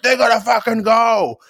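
high, hammed-up yell; mock angry shout. Fan yelling at rival fans, mock angry shout. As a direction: bark it like an annoyed fan. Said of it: "a couple guys down were like".

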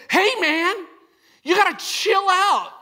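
a buddy telling you to cool it; imitating a friend's warning. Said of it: "chased me down and said".

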